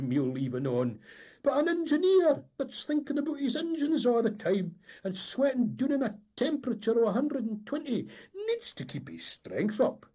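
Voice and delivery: high, loud aggrieved complaint; indignant engineer's rant. Acted. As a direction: rant about his hard lot.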